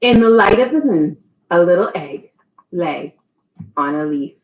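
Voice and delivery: put-on reading to a child. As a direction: read it like a bedtime story. Reads picture book aloud.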